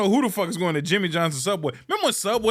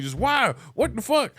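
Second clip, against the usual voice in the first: ordinary talk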